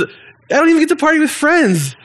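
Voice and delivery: high, whining complaint, mimicking the sulking son; whiny older brother. Older brother whines to his father about the party. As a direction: poke fun at a sulky grown son's whine.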